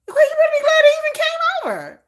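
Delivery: high, fast, sassy put-on voice; sassy retort. Retort to the fan, high fast sassy voice. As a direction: snap it back with attitude. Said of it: "So I was like".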